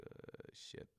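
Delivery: very soft, voiceless, dismayed aside; caught-out mutter. Retold or imitated. Girlfriend asks after her cupcakes, guilty mutter. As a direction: mutter it under the breath, as if found out.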